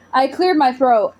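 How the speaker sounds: ordinary delivery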